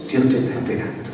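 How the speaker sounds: hushed, low, quickly spoken comfort; gentle assurance. Retold or imitated. Robber asks to return, pastor gives gentle assurance. Answers whether he may return.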